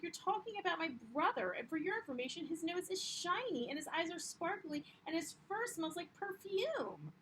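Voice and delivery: a hammed-up, offended child sticking up for her brother; indignant little sister. Teacher voicing Lily defending her baby brother, hammed-up and indignant. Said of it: "She's like".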